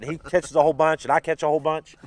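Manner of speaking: ordinary delivery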